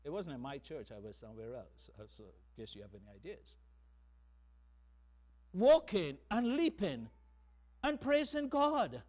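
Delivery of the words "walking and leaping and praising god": proclaimed loud. Acted. Preacher shouting the refrain to the congregation, ringing loud. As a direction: ring it out high and loud.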